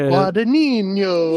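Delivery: put-on loud, high Italian accent. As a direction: roll the name out in an Italian accent. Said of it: "doing an Italian accent".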